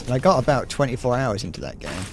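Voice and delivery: ordinary delivery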